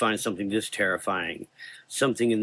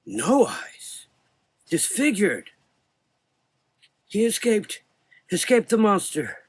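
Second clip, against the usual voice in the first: put-on high, loud distressed old voice; agitated old man; 9 semitones higher, 3 dB louder than usual. A narrator's reading. Old doctor recounts the missing boys in alarm. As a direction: spill it out in rising alarm.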